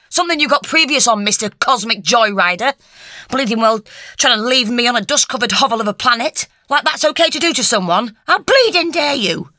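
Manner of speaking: high, loud furious rant; furious tirade. A narrator's reading. Lucy berating the doctor, furious tirade. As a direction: tear into him without pause. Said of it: "Lucy unleashed a full-force glare".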